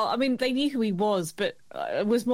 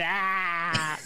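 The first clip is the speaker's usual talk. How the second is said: lower, drawn-out amateur politician impersonation; long mock-politician drawl; Bob Hawke; 4 semitones lower than usual. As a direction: stretch it out like a parent mimicking a politician. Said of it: "doing their Bob Hawke impression".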